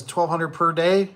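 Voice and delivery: high, slow disbelieving rise on "day"; Jeremy's doubtful question, slow and high; incredulous question. Retold or imitated. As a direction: draw out "Twelve hundred per day?" like you can't believe it. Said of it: "Jeremy says".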